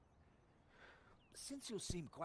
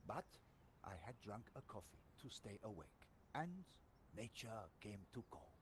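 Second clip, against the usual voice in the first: put-on storytelling French sergeant accent; a French soldier confessing; lightly held French accent; 8 dB louder than usual. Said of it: "you just get a French accent".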